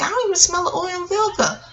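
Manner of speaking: high-pitched mock puzzlement; puzzled doubt. Retelling her search for the sandwich shop, puzzled doubt. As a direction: voice pushed up high, imitating doubt.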